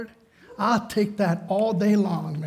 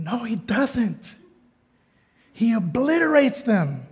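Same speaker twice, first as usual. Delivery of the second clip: inner retort; 5 semitones higher, 3 dB louder than usual. Retold or imitated. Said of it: "but I'm thinking".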